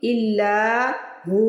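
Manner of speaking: इल्ला then हु held long; recited with pause. Shown as a demonstration. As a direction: stop on हु and stretch it. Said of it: "अब मैं वक़फ़ करूँगी". (वक़फ़ के साथ हु को खींचना)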